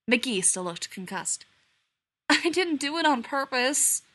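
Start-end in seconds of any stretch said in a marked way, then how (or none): none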